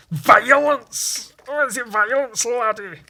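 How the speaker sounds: high, loud Scottish outburst; hammed-up Scot crying out; shrieky cartoon Scottish brogue; Scottish accent. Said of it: "Or if it was Scottish".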